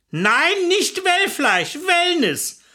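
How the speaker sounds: high, drawn-out correction; impatient, slow and high; exasperated correction. Acted. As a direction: slowly set him straight, pitched high. Wife corrects husband's mishearing.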